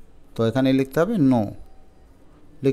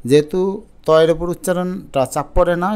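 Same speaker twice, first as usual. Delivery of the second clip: ordinary delivery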